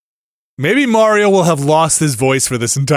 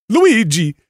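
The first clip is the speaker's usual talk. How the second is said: higher cartoon Italian accent, rising bright; mock the classic Italian plumber voice, full-on; cartoon Italian accent urging his brother on; Charles Martinet Mario; 9 semitones higher than usual. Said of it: "the full-on Charles Martinet".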